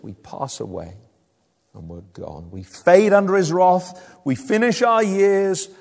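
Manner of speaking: ordinary talk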